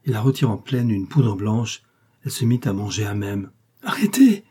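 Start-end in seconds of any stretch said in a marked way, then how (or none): 3.8-4.4 loud, high-pitched cry to stop; alarmed cry. A narrator's reading. Justin throws himself on her, alarmed cry. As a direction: cry out to stop them at once. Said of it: "s'écria-t-il en se jetant sur elle".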